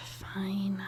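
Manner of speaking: whispered on a sigh; soft, defeated exhale; resigned. Acted. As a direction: give in very softly, almost all breath. Reluctantly gives in, shutting off.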